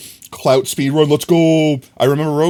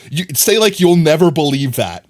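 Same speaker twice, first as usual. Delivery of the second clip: usual speaking voice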